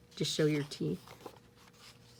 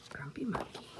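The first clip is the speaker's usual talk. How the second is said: whispered grumpy monkey; 3 semitones lower, 11 dB quieter, faster than usual. Acted. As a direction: breathe the words softly. Whispered repeat.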